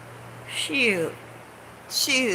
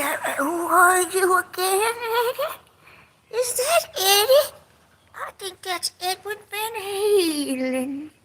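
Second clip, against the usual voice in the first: mock confused; 11 semitones higher than usual.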